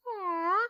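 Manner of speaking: high little whine; imitated dog whine. Owner imitating Lucy whining in front of her, high whine. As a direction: whine like a dog begging for attention. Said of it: "just kinda whine".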